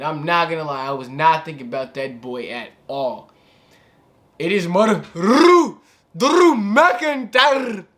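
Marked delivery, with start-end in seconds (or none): 4.4-7.8 a failed attempt at a Scottish accent, higher and louder. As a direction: try a Scottish accent, very slow on McIntyre. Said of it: "I can't do that accent".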